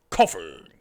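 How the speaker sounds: Coffin barked low and loud, falling; Coffin said emphatically. Shown as a demonstration. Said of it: "Coffin with a K, by the way".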